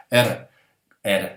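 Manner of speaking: short "R" with a rolled r. Shown as a demonstration. Teacher retries the Russian R, rolled demonstration. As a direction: roll the tongue on "R". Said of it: "j'ai du mal à rouler".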